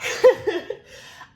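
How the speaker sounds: high unvoiced laugh through tears; teary laugh. Real, not acted. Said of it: "getting ready to cry".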